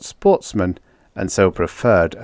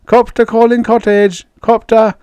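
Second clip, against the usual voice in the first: radio call; 14 semitones higher, 7 dB louder than usual. Acted. Calling over the radio.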